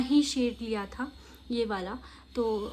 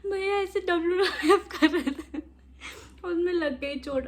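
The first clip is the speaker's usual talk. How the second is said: higher, laughing fake-crying complaint; mock tearful tattling; 8 semitones higher than usual. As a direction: whine to dad while laughing. Tattling to dad with fake tears. (पापा से हँसते हुए नकली रोकर शिकायत)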